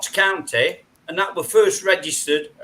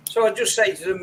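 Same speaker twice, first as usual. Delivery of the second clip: ordinary talk, nothing marked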